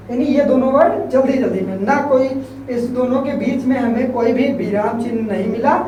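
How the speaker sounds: ordinary talk, nothing marked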